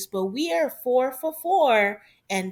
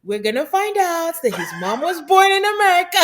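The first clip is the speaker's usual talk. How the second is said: laughing while quoting; 7 semitones higher, 7 dB louder than usual. Retold or imitated. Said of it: "I just said".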